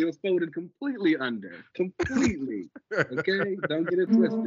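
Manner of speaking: high, sassy correction; sassy insistence. Acted. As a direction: set the record straight with sass. Insisting on the hair detail.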